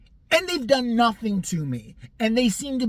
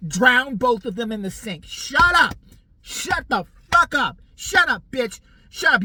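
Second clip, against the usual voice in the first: loud, angry shouting; annoyed customer rants loudly at chatty neighbors; inner rage; 6 semitones higher, 9 dB louder, slower than usual. Acted. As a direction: snap at them, fed up.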